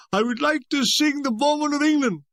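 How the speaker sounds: high catarrhal concert announcement; pompous tenor. A narrator's reading. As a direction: announce your song in a mock tenor voice. Tenor announces his song.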